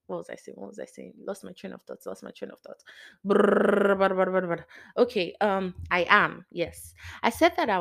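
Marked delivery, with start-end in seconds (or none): 0.1-2.8 much softer, under the breath; quick hushed self-prompting; muttering to self. Real, not acted. Speaker talking to self after losing the train of thought, muttering. 3.2-4.6 loud rolled brr noise; lip-trill brr. Real, not acted. Speaker resetting after a lost train of thought, sputtered brrs.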